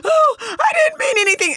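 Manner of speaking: high, whiny fake sob; mock crying. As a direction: parody a fragile crying woman. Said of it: "They start crying".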